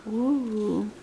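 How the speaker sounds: soft, hushed mock gasp of wonder; whispered ooh of delight. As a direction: marvel quietly for the child.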